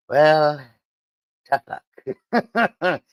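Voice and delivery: dismissive mock boss, breaking into laughter; Trump. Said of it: "go to Trump and be like".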